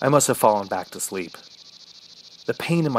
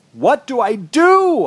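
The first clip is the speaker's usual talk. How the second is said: high, drawn-out, louder cry; desperate yell; 12 semitones higher, 6 dB louder, slower than usual. A narrator's reading. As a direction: yell it in despair, stretching the last word. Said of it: "I yelled".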